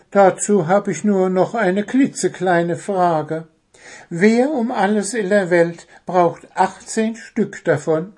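high, querying bird voice; nitpicking little bird. Acted. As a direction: quibble as a small puzzled bird. Voices Zwitschi questioning the task.

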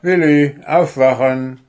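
loud wake-up call. A narrator's reading. Dwarf calls to the sleeping owl, loudly. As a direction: call out loudly to rouse someone. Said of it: "rief Puh".

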